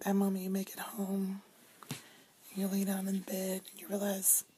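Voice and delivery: soft tired whisper. Real, not acted. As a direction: hush it, exhausted at night. Tired late-night whisper.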